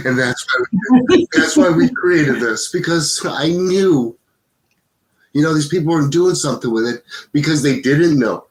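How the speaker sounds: fighting real tears while explaining the mission; tearful. Real, not acted. Founder tearing up as he explains why they created this, tearful. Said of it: "I don't know why I'm so crying so much".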